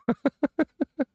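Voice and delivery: short high-pitched burst of laughter; a quick giggle at the title. Real, not acted.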